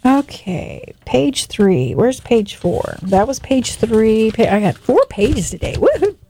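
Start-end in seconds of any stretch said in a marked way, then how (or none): 5.7-6.2 high cheer at a small victory; a quick happy woohoo; excited whoop. Real, not acted. Host cheering her four pages on air.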